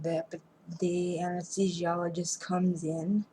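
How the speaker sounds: ordinary delivery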